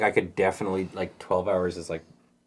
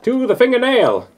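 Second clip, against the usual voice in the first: higher, louder radio announcer voice; old timey radio man; 11 semitones higher, 5 dB louder than usual. Pretend. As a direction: narrate like a vintage radio host. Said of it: "my old timey radio man voice".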